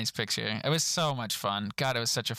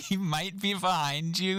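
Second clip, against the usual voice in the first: amused disbelief; 6 semitones higher than usual. Retold or imitated. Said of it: "I don't know why this line is so funny".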